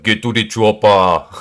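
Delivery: loud Austrian-accented action hero; Arnold. Shown as a demonstration. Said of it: "Arnold's accent is actually really difficult to copy".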